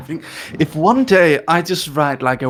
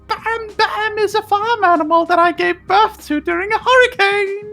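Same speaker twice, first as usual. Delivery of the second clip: very high, loud squealing Fred impression; high-pitched centaur; 5 dB louder than usual. A narrator's reading. Centaur performing for the queen, high squeal. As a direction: keep the tea kettle screech going. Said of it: "in a high-pitched voice like a screaming tea kettle".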